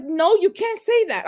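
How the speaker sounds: high-pitched, acted-out objection; no way, you can't; indignant protest. Protesting the builder's refusal.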